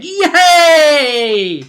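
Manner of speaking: long, high, loud whoop of glee; gleeful shouted cheer; mock cheer. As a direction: cheer it out big and drawn out. Mock cheer for undead fun.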